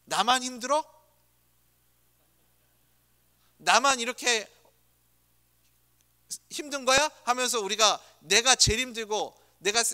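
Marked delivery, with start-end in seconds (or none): 0.0-7.1 higher, aggrieved whine; imitating a sulky spouse's grievance; mock marital complaint. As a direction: complain like a tired spouse in a fight. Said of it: "늘 우리가 하는 멘트가 그거잖아요".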